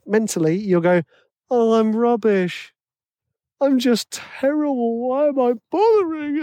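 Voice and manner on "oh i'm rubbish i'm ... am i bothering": mock self-pity. Self-doubting composer in wrong headspace.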